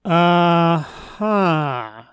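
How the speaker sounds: long, drawn-out doubtful uh-huh; sceptical. Real, not acted. Commentator reacting to the speaker's claim, sceptical. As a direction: stretch it out, unconvinced.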